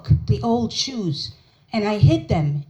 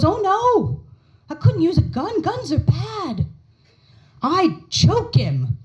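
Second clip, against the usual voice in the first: higher, slow, oddly sweet mother voice with a menacing punchline; mock stern mother; 7 semitones higher, slower than usual. Tiny mother tells her child she'd rather choke Putin, sweetly menacing. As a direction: voice the tiny mother calmly plotting to choke him.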